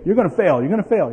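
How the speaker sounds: loud mocking under-the-breath jeer. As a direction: taunt the kid as he dawdles. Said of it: "you know, like that".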